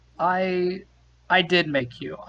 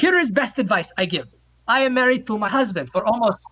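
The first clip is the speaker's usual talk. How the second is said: lightly put-on Norwegian accent, a bit higher; mock Norwegian accent; 4 semitones higher than usual. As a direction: play the Norwegian stranger in broken English. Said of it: "this accent is".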